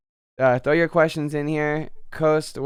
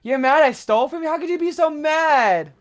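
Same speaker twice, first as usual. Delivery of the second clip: mock incredulity; 12 semitones higher, 4 dB louder than usual. Scalper acting baffled at the man he stole from, high mock incredulity. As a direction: exaggerate the absurd protest in a high voice. Mocks the thief's indignant reaction.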